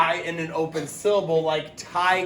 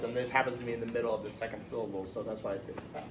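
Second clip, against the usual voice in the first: fast, much softer trailing explanation; rushed aside; 5 semitones lower, 10 dB quieter, much faster than usual. Real, not acted. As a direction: hurry through the aside. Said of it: "quick aside explaining".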